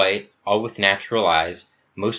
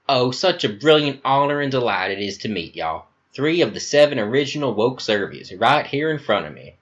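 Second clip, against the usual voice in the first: higher-pitched, effusive American Southern voice; flamboyant Southern accent; 3 semitones higher than usual. Acted. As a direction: gush theatrically in a Southern accent.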